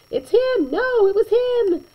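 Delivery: high squeaky blame game; childish it was him; bickering kids. Acted. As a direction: point fingers like little kids. Kids blaming each other.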